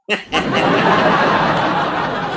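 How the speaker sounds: a breathy, amused laugh at her own joke. Acted. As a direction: laugh off the teasing about lipstick.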